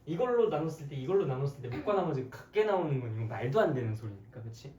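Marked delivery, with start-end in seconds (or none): none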